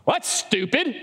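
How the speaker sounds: mock sneer at the idea; scoffing. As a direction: brush it off like a sceptic. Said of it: "And you're going".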